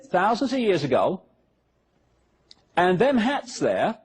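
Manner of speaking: usual speaking voice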